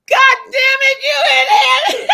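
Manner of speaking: high, loud mock outburst of cursing; imitated cursing. Sergeant cursing out the recruit, a high, loud mock rage. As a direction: blow up at her like the sergeant did.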